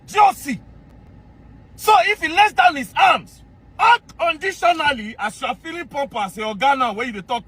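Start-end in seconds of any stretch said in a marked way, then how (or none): 0.1-5.2 scornful shout. Real, not acted. Ridiculing the army general's appeal, shouting high and scornful. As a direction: throw his rank back at him.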